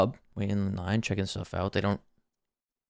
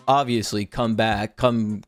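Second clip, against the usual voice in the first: ordinary talk, nothing marked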